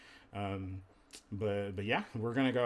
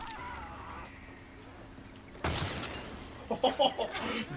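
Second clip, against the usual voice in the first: high breathy laugh; 9 semitones higher than usual. Real, not acted. Laughing at teammate's double kill.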